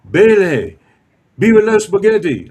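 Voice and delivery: put-on high, loud Italian accent, arms wide; cheerful Italian greeting. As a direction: hail it like a proud Italian chef. Inventor greets him in Italian.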